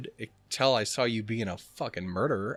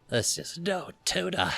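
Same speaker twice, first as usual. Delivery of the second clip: snooty cat voice; 6 semitones higher, slower than usual. Acted. Voicing the finicky cat.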